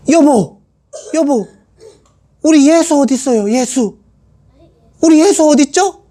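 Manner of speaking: Mary fretting over her lost son; worried mother. Acted. Mary anxiously asks Joseph where the boy is, pleading.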